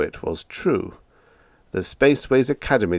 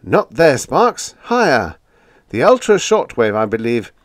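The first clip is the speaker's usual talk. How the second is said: urgent; 5 semitones higher, 5 dB louder than usual. A narrator's reading. Biggs urgently stopping Sparks.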